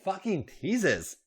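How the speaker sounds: high, slow frustrated growl; acted growled complaint. A narrator's reading. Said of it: "Becky growled".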